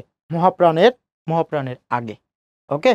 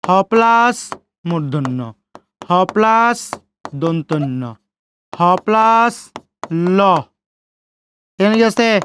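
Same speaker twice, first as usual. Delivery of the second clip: ordinary delivery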